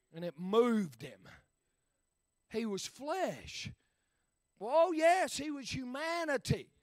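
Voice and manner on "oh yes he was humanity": ordinary talk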